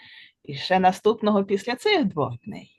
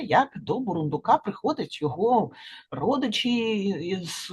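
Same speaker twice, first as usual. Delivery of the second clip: usual speaking voice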